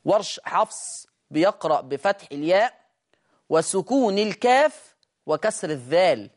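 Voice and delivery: normal talking voice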